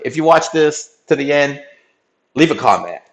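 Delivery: usual speaking voice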